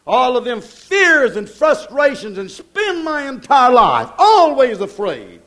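rising, driving sermon cadence, hammering each repeat; preaching at full force. Acted. Preacher hammering the congregation about worry, full-force preaching. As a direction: preach it, pound every worrying.

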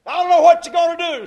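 quick, high and loud; loud retort. Retold or imitated. Preacher voicing Pharaoh's scornful answer, fast loud retort. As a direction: fire it back fast and loud.

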